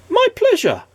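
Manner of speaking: very high, sing-song reply; very high woman's voice. A narrator's reading. The woman answering Mister Good, very high. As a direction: lift it up high and cheery. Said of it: "said the woman".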